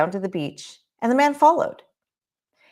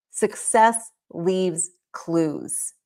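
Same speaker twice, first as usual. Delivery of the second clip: very slow, each word weighted; a slow, weighty motto; slow emphasis; much slower than usual. Shown as a demonstration. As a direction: drag it out for impact. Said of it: "Hear me on that".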